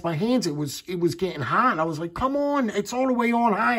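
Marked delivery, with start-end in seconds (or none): 2.1-3.8 high-pitched mock holler; retold hollering. Husband hollers to wife as flashlight heats up, high mock yell. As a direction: call it out high and urgent, half-joking. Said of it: "I'm hollering".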